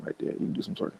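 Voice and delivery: hushed unvoiced aside; whisper. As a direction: whisper it like a secret instruction. Acted scene, whispered aside.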